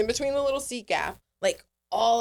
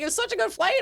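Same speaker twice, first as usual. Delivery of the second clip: mock relieved flyer; 11 semitones higher, faster than usual. Repeating the flyer's line to mock it.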